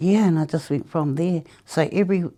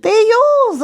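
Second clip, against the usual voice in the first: much higher, louder and thrilled; excited reveal; 10 dB louder than usual. Retold or imitated. Tracy revealing the prizes are hers, much higher and louder. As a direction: deliver it like a big surprise.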